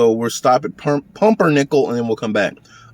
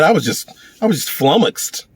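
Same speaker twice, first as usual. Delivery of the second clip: acted flummoxed; 5 semitones higher than usual. Someone explaining what he was told, acted flummoxed. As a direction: play taken aback, pitch up.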